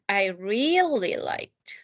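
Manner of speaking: "really" slowly and clearly, word by word. Shown as a demonstration. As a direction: draw out "I really liked" slowly for the class. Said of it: "slow repeat of model".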